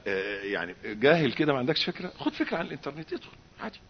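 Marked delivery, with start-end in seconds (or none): 2.2-3.8 whispered coaxing; whispered temptation; devilish whisper. Retold or imitated. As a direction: lure him in under the breath.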